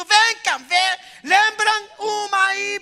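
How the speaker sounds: loud, high, excited summons; a host calling the whole room in; joyful calling out. Retold or imitated. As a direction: wave everyone over in delight. Host joyfully calling everyone over.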